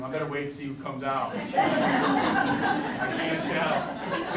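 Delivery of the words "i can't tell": very slow, breathy laughing words; laughing at own confusion. Real, not acted. Laughing at restroom decals.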